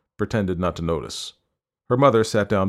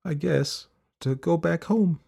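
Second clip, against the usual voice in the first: put-on high, halting child's voice; tired girl; 9 semitones higher than usual. A narrator's reading. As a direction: work it out haltingly for your mother. Tired girl explains friend's departure.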